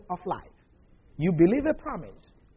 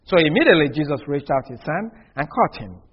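usual speaking voice

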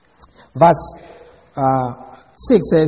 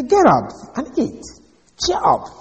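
bossy wife; 9 semitones higher, 4 dB louder than usual. Acted. Jezebel scolding her sulking husband.

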